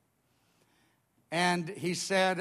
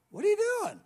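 high-pitched imitated question; friend's voice shooting way up high; squeaky, high "just woke up" question; startled waking friend; much faster than usual.

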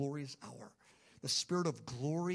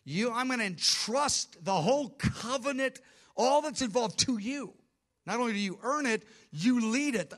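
higher and louder, proclaiming; the Father commissioning the Son, exalted and loud; commanding Father voice; 6 semitones higher, 5 dB louder than usual. Acted. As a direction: hand over the covenant with authority. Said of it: "He says".

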